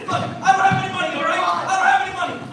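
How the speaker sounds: loud, fast, frightened pleading; panicked pleading. Acted. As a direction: blurt it out like a scared victim. Mugging victim pleads in skit.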